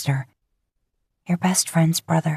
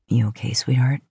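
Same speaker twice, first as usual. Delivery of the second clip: a lightly lowered man's voice; gentle check-in, a touch lower; Dean's low voice; 5 semitones lower than usual. A narrator's reading. Man asks his lover if she's okay, lightly lowered voice.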